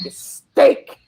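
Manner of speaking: scolding mom. Retold or imitated. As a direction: bark it like a mom at her smelly teen. Mom voiced scolding teenager.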